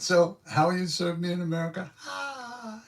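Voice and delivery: high, teasing imitation of the guru; mock-gleeful high-pitched ribbing; laughing guru. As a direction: tease gleefully, ending in a squealed "ah". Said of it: "He laughed. He said".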